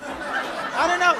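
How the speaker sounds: high, slow mock exasperation; fed-up woman. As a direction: imitate a woman who's had enough. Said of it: "She's the type".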